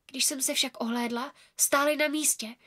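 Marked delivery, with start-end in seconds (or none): none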